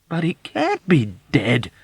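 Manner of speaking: loud, high, slow arguing cry; insistent protest. Acted. Yossarian insistently protesting to the old woman. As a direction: argue it, refusing to accept. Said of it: "Yossarian cried, ready to argue insistently".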